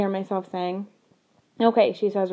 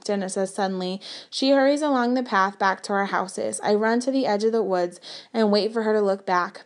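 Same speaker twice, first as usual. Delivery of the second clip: ordinary delivery, nothing marked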